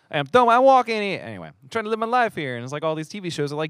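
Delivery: high, hammed-up New York accent; exasperated fake New York voice. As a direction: rant like a mock New Yorker. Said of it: "New York accent".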